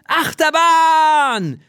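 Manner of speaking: lang gedehnter, hoher Jubelruf; jubelnder Schrei. A narrator's reading. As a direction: juchze wie auf der Achterbahn. Wilder Sturzflug am Himmel. (gedehnter Jubelschrei)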